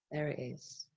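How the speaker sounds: very soft, sighed out; relieved sigh. Acted. Acting mind's relief at stillness.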